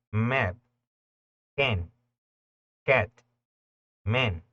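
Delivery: map, can, cat, man with a vowel between A and E; English ash vowel; English short a. Shown as a demonstration. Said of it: "suena entre una A y una E".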